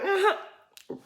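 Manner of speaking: high knowing uh-huh; a sly sideways uh-huh; high, teasing imitation of Giselle; mock Giselle uh-huh. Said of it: "here comes Giselle like".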